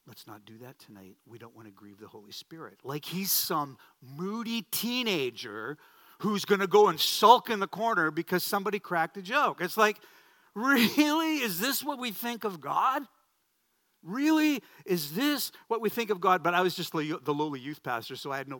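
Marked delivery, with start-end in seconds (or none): 0.1-2.8 very soft, low, pious scolding; hushed solemn rebuke. Retold or imitated. Man reproves a young pastor for joking before prayer, hushed and solemn. 10.5-16.4 high, loud, exasperated questioning; incredulous protest. Retold or imitated. Young pastor objects to a somber view of God, incredulous. Indignant reply to the pious rebuke.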